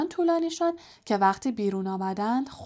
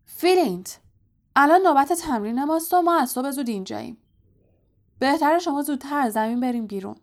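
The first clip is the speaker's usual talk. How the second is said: fast, raised, higher-pitched protest; angry bellow; 5 semitones higher, 5 dB louder, faster than usual. A narrator's reading. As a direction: shout down the rival captain. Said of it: "وود نعره زنان".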